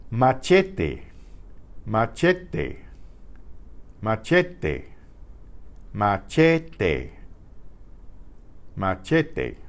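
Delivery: "Machete" with clean E vowels and an unaspirated T; teacher model "Machete" over and over; "Machete", no English diphthong; Spanish pure vowels. Shown as a demonstration. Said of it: "each vowel is its own pure sound".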